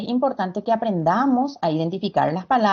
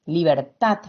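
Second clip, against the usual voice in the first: "libertad" with a firm final TAD; demonstrating the stressed TAD in "libertad"; stress on final syllable; 6 semitones lower than usual. Shown as a demonstration. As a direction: say "libertad" leaning on the last syllable. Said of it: "Pronunciamos más fuerte la última sílaba".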